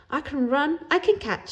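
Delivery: high, bright bragging voice; boastful child. Acted. Kelly bragging to the boys, high and eager.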